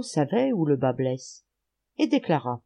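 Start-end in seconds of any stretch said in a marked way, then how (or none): none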